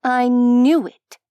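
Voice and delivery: loud, high, slow triumphant declaration. A narrator's reading. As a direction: nail it like you were right all along. Vani triumphant after catching kids.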